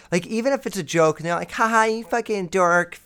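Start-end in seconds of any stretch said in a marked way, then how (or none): 1.5-3.0 put-on high teasing girl voice, up top; mocking girl. Girl teasing a guy as she twists his nipple, high and mocking. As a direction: toss it off like a smug prankster.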